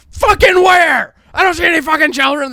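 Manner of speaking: high-pitched, furious yell; loud, exasperated outburst; raging dad. Retold or imitated. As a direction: shout back like an imitated dad in a rage. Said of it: "my dad's just raging".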